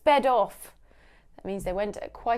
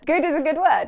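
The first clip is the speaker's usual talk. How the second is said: higher and louder, sing-song praise; plain; 6 semitones higher, 7 dB louder, faster than usual. Shown as a demonstration. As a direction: say it like praising a child brightly.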